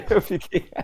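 giggling through the words; laughing. Real, not acted. Friend finishing a funny story about dad, laughing. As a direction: let real laughter interrupt the line. Cracking up over own story.

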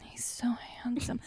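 breathy whispered swoon. Retold or imitated. Watching the handsome actor on screen, whispered swoon. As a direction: whisper it like a smitten fan. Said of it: "I just kept being like".

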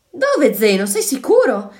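high, loud anxious questions; alarmed shout. A narrator's reading. Anna running away after Zeno, alarmed shouted questions. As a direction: call out urgently while fleeing. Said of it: "gridò Anna, scappando anche lei".

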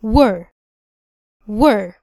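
were said with lips rounded a little. Shown as a demonstration. Teacher drilling were with rounded lips. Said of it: "بنضم فيها الشفتين شوية".

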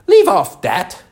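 high, loud royal command; screamed. A narrator's reading. The Queen screams at the bowing gardeners. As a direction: scream at them to stop. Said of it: "screamed the queen".

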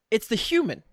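normal talking voice

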